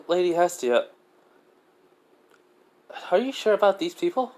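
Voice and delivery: put-on high, wary young woman's voice; a clerk uneasy about strangers; doubtful guild girl. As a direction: Edna asking Hestia about the newcomers, high and wary. Edna doubting the newcomers.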